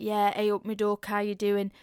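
loud, thick Mansfield accent greeting. Shown as a demonstration. Student thickening their accent for the director, broad Mansfield. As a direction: lay on the broad Mansfield dialect. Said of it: "That is how Mansfield people talk".

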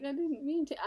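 high-pitched mock tearful plea; imitated crying. Host imitates a possessed man's manipulative crying, mock tearful. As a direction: pitch it up, feigning tears. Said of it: "he starts crying".

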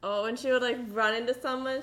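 ordinary talk, nothing marked

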